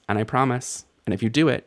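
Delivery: ordinary talk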